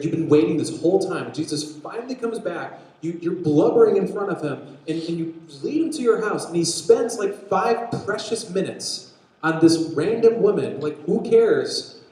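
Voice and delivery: loud, high, indignant venting; exasperated rant. Acted. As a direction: act out a father's mounting frustration. Acting out Jairus's impatient frustration.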